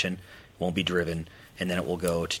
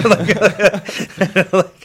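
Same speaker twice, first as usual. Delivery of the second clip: laughing; 16 dB louder than usual. Real, not acted. Laughing after the bit.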